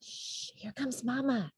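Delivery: hushed hiss, soft and urgent; whispered shush; hissed warning. A narrator's reading. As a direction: hiss so Mama won't hear. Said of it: "hissed Pip".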